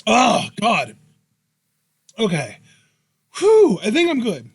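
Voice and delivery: strained, recovering after coughing; after a coughing fit. Real, not acted. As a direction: catch your breath after choking. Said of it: "spit went down the wrong pipe".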